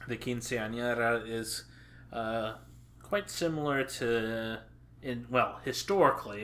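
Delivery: normal talking voice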